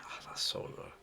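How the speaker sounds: soft whispered aside; whispering. Real, not acted. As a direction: breathe it out in a whisper. Whispered delight at own line.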